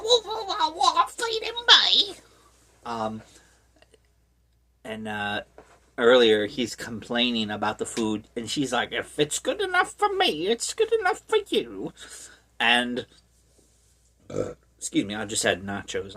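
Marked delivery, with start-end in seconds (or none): 0.0-2.1 squawky falsetto mother, loud; hammed-up falsetto outrage; mock shrieking mother. Voicing the mother's shocked reply. 8.9-11.9 very high falsetto mom voice; hammed-up falsetto nagging; mock scolding mother. Voicing the mother scolding her son.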